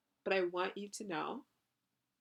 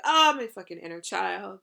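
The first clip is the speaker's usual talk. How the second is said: mock fed-up outburst, louder; exasperated groan; 11 dB louder than usual. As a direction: imitate someone sick of their inner child, loud. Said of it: "It's like".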